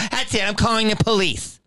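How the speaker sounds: high-pitched mock ultimatum; threatening lady. Hotel lady threatening the customer with police, high and indignant. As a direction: imitate her reaching her limit.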